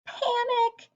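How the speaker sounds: high frightened duck yelp; mild mock panic, pitched high; panicked cry. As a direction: squeal in sudden fright. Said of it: "She was really scared".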